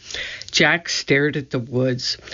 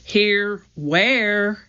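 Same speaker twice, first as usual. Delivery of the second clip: higher puzzled call; calling back; 6 semitones higher than usual. A narrator's reading. Jack calling back to unseen Annie, puzzled call. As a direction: call back unsure where she is. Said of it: "he called back".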